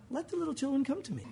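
soft, meek invitation; mild and quiet; soft gentle version. Shown as a demonstration. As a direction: say it mildly, not firm. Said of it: "I bet it wasn't".

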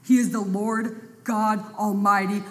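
ordinary talk, nothing marked

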